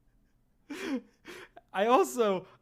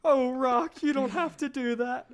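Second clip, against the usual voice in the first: pleading manager; 7 semitones higher than usual. Acted.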